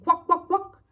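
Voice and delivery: bright duck quacking. Acted. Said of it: "said the duck".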